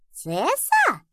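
high questioning kitten squeak; high-pitched, puzzled baby voice; kitten asking back. A narrator's reading. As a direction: echo it as a tiny kitten's question. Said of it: "переспросила Руби".

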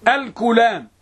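الكلام with a short "u" after the k, al-kulaam; teacher's demo of الكلام with kaf as "ku"; damma on kaf. Shown as a demonstration. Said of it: "وبالضم".

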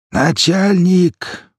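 drawn-out hoarse call; hoarse shout. Acted. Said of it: "Кто-то, охрипший". (хриплый крик)

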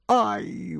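high little yelp; lady's sudden exclamation; startled cry. A narrator's reading. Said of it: "вскрикнула дама". (высокий вскрик дамы)

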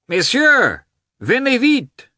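high, loud excited calling. A narrator's reading. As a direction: call them over with glee. Veslovsky calls excitedly.

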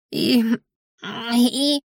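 halting, quavering little stammer; trembling voice. A narrator's reading. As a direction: falter on the word, voice wobbling. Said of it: "её голос подрагивал". (дрожащим голосом)

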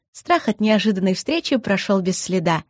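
ordinary delivery

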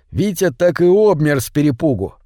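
plain reading voice

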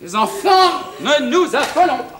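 high-pitched call for calm; flustered rallying. Acted. Husband rallies household without lunch, high and flustered. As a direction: pipe up to stop a panic. Rallies them, urging no panic.

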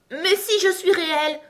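high, loud upset insistence; tearful protest. A narrator's reading. Alice insisting she is real, tearful protest. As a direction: insist as if about to cry. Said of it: "affirma Alice en se mettant à pleurer".

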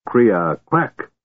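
muttered foreign words of disgust; old mage turning away in disgust. A narrator's reading. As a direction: spit it out with distaste. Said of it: "the man said in disgust".

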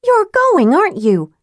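high accusing cry. A narrator's reading. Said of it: "she cried".

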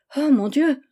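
alarmed little cry; frightened cry. A narrator's reading. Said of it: "Léontine effrayée".

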